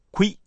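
クイ as one syllable, "kwi"; one-breath diphthong. Shown as a demonstration. Teacher instructs learners, クイ said in a single beat. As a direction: glide クイ together in one go. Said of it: "一気に発音してください".

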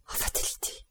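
very soft, voiceless whisper; murmured low. A narrator's reading. Bertuccio, crushed on seeing Benedetto, murmurs low to himself. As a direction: let it out as a stricken whisper. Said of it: "murmura-t-il tout bas".

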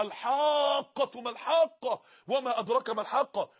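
slow, chanted Quran recitation; recited verse. Acted. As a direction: intone the verse slowly.

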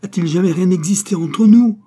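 high, loud indignant questions; outraged exclamation. A narrator's reading. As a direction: challenge him in full-voiced outrage. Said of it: "exclama Baccarat".

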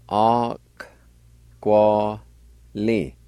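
"All Quali" stretched out syllable by syllable; syllable-by-syllable pronunciation. Shown as a demonstration. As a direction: say "All Quali" very slowly, pausing between syllables. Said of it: "it's pronounced like this".

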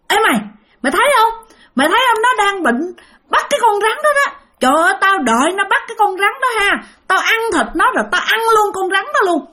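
high, piercing ghost chatter to a companion; ringing high spirit voice, eager and gloating; shrill ghost voice. Acted. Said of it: "lanh lảnh như vậy đó".

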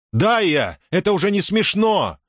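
high-pitched, indignant calling out; exasperated call. A narrator's reading. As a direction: call to her, voice jumping up in annoyance. Муса зовёт Даю, раздражён. (возмущённый окрик высоким голосом)

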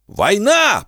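high shouted war cry. A narrator's reading. Pekin shouts war to the gang, high yell. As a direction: shout it to rouse everyone. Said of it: "прокричал Пекин".

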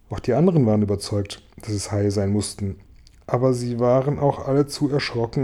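usual speaking voice

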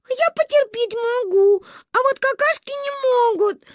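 put-on a tiny girl about to cry; tearful toddler. Gayane bursts into tears to Ba: high tearful toddler voice. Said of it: "расплакалась Гайне".

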